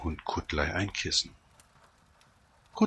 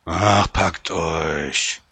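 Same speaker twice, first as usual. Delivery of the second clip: loud, drawn-out bear growl; big grumpy bear rumble; grumbling bear; 8 dB louder, much slower than usual. A narrator's reading. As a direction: growl it slowly, shooing them away. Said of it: "brummte der Bär".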